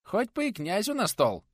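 high, proud peasant voice; boasting. Acted. Nikodim boasting of his mead, high and proud. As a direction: brag it gleefully.

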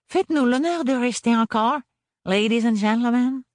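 put-on high, polite little boy voice; extremely polite child. A narrator's reading. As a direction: menace them with sweet politeness. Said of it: "dit-il avec une extrême politesse".

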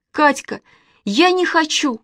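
high, pleading outcry; scared child's high protest; frightened cry. A narrator's reading. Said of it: "закричала она".

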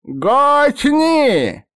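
put-on high, loud screeching old man cry; shrill old man yell. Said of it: "визгливо закричал".

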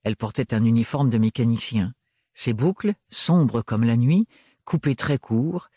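plain reading voice

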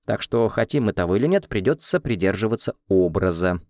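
ordinary delivery, nothing marked

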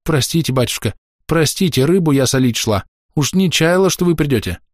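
rattling off apologies, bowing; gabbling. Acted. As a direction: gabble it out, high and anxious. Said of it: "зачастила, кланяясь".

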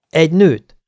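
impatient. A narrator's reading. Wyatt answering the soldier's question, impatient. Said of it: "felelte türelmetlenül Wyatt".

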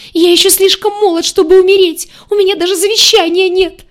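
high, loud, hysterical wailing; terrified squeal. A narrator's reading. Crocodile protests he is too young to die, squealing. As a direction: shriek it as if about to die. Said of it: "визжал крокодил".

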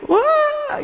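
high sudden cry, mock; retold wail. Preacher imitates worshippers crying out, high wail. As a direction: yelp it like a sob.

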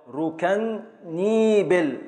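ركنيبل spoken very slowly, syllable by syllable; slow scansion. Shown as a demonstration. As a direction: drag out ركنيبل to show the beats. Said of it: "من أول الراء". (تقطيع بطيء جدا)

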